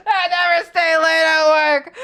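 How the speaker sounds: high, loud, slow screamed refusal; hammed-up crying yell; mock shout. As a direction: yell it like a tantrum. Repeated yelled.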